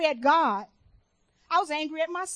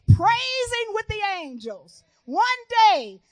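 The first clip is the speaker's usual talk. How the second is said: rousing preaching; 4 semitones higher than usual. Real, not acted. Preacher promising the church a heavenly reunion, rousing and building. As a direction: build the promise, stretching the big words.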